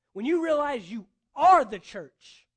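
slow, loud declaration; preaching peak. Acted. Pastor tells the congregation who they are, loudly. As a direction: hammer home you are the church.

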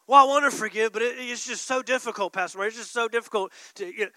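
loud mock whine to the pastor; whining believer. Believer complaining to Pastor Murray, mock whine. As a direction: plead that it's too hard.

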